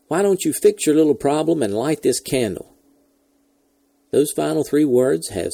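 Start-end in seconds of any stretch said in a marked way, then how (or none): 0.1-2.7 raised, impatient radio retort; irritable bark. A narrator's reading. Astronaut urging launch control to fix the problem, irritable bark. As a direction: push it out higher, fed up. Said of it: "he barked".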